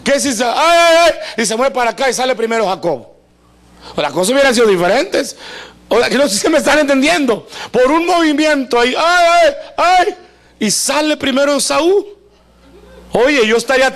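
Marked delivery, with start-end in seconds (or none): 0.5-1.4 high, loud mock cries of pain; hammed-up birthing groans; labour cries. Preacher acting out Rebekah giving birth, mock pain cries. 9.0-10.1 high, loud mock cries of pain; hammed-up birthing groans; labour cries. Preacher acting out Rebekah giving birth, mock pain cries.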